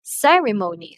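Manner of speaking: "ceremony" with CE high and loud, then falling; high-pitched stressed syllable. Shown as a demonstration. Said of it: "and the pitch".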